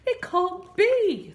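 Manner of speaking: high, loud disbelief; spluttering king. A narrator's reading. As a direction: cry out slowly in shocked disbelief. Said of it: "the king spluttered".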